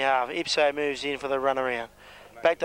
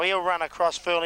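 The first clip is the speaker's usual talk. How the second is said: ordinary talk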